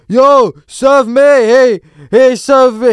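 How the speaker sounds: high, drawn-out demanding yell; mock accented shout at a shop worker; imitated shouting. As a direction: bellow for service. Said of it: "this guy starts shouting at her".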